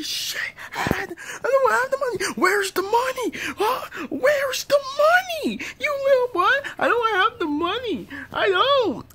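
very high panicked little voice; squeaky scared kid. Acted. Kid who owes money, high and panicked.

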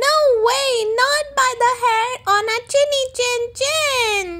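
high piglet voices, defiant; three little pigs defying the wolf; piglets crying out. A narrator's reading. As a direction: squeal back like little pigs. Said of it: "cried the three little pigs".

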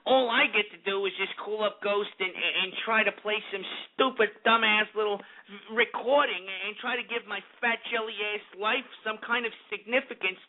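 lightly mocking impression of a sore complainer; mock a hurt listener making excuses. Host mocks listeners with hurt feelings, mock-aggrieved. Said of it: "people's feelings get hurt".

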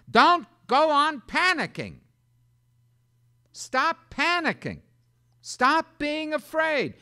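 high, loud, slow Stop panicking; shouted command. Shown as a demonstration. Preacher shouting at a panicked congregation, loud and high. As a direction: bellow it at the scared flock. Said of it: "perhaps translate this myself".

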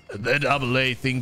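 lightly put-on champion voice; game villain quote, lightly acted; Aatrox. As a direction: deliver it as an in-character quote. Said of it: "Aatrox quotes are just the best".

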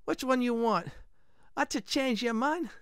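high mock-Italian shopkeeper; bad Italian accent. Blacksmith questioning the customer, bad Italian accent. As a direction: ask it in a hammed-up Italian accent, pitched high. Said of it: "this is gonna be very bad Italian accent".

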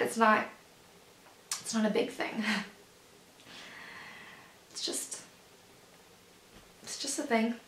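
very soft, halting close; subdued downplaying. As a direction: play it down quietly, with long pauses. Subdued playing down of the condition.

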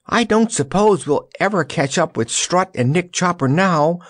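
high-pitched mild scream; mock scream, pitched well up. A narrator's reading. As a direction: call out shrilly over the wind. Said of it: "screamed Jellia".

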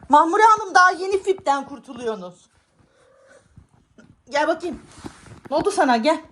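high, loud mock-stern talk to the cat. Real, not acted. Owner scolding her cat Mahmure in high pet talk.

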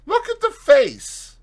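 high, insistent order; insistent command. Acted. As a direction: repeat it flatly, insisting. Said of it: "was the only answer".

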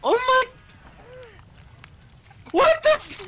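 very high, loud shriek; shocked shriek. Real, not acted. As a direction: shriek in disbelief. Shocked by game glitch.

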